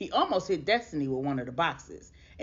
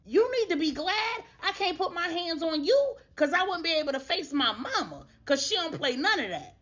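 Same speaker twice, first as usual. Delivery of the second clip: higher, fast, heated man's voice; angry confrontation; 12 semitones higher than usual. Retold or imitated. Father confronting the woman who hid his son, heated and indignant. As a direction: tear into her, indignant. Donovan confronts Destiny angrily.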